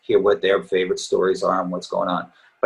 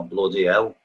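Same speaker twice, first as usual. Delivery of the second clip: nasal Liverpool [name] voice; nasal Liverpool accent, say it like John. Shown as a demonstration. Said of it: "like that".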